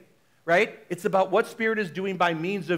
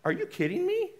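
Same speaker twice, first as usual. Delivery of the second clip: disbelief; 8 semitones higher than usual. Retold or imitated. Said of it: "I'm like".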